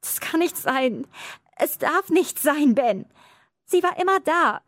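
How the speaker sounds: crying. A narrator's reading. Girl weeps to her brother over the dead woman, crying. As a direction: voice breaking into tears. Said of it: "ein Tränenschwall unterbrach mich".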